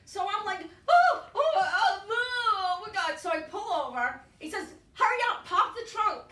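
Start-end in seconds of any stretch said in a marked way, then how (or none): 0.9-3.2 high, loud hammed-up wail; comic meltdown, loud and high; panicked wailing. Retells her panic while driving. 4.9-6.2 high, loud shouted command; urgent order. Retold or imitated. Mechanic ordering her urgently.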